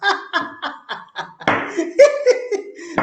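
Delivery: unvoiced high chuckle; breathy high laugh. Real, not acted. Risa tras predicar. (risa aspirada aguda)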